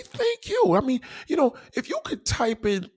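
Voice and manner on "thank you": much higher, sincere "Thank you."; proper thank you. Shown as a demonstration. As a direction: lift "Thank you." up high and friendly. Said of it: "Just say".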